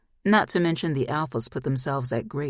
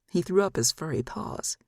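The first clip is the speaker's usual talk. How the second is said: ordinary delivery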